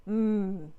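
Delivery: a short loud moaning hum.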